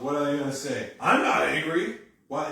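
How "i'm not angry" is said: loud, raised angry denial. Acted. Confronted person denying their anger, very angry. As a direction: deny it in an obviously angry voice. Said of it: "In a very angry voice".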